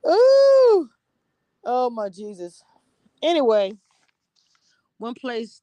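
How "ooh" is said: shrill-free big high ooh, much louder; delighted loud ooh. Real, not acted. Reaction exclamation.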